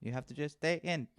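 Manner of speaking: a hint of a Mexican dad's accent. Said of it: "Is that supposed to be your Mexican accent?".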